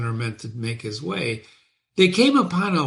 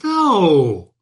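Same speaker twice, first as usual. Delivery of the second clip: fiend's firm no; 4 semitones higher, 8 dB louder than usual. Acted. Fiend answers summoner.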